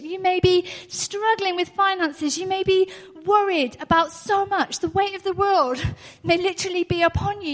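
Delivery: high, loud, impassioned preaching; rising fervent sermon cry; preaching at full force. Real, not acted. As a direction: press the facts home to the congregation. Preaching build.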